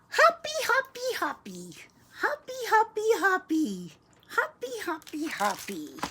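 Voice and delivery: put-on high, bouncy little-boy chant; little boy as frog. Boy playing frog for the grocer, bouncy. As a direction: hop along on the words, dropping at each end. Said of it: "the little, uh, boy says".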